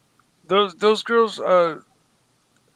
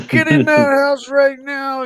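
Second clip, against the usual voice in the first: higher, louder, slow mock parental scolding; 9 semitones higher, 6 dB louder than usual. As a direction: bark it like a mom calling a kid in. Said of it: "Then you really knew you were in trouble".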